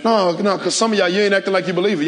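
ordinary talk, nothing marked

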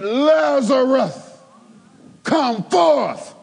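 high, slow calling out; loud command. Acted. Jesus calling Lazarus from the tomb, loud high command. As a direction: call the dead man out of the grave. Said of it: "He spoke loudly".

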